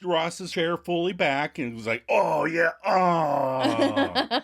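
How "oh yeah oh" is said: hammed-up relaxed moan; mock bliss. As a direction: melt into the recliner. Ross reclining fully.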